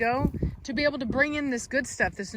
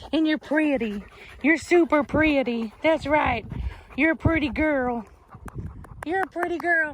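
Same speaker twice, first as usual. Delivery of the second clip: louder, higher doting coo; praising pet talk; 3 semitones higher, 3 dB louder than usual. Real, not acted. Breeder loudly doting on her young female dog. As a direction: dote on the dog out loud.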